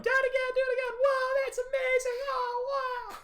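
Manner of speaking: put-on very high, loud excited child voice; yelling little girl. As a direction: squeal it like a thrilled little girl. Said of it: "little girl hopping up his leg, yelling".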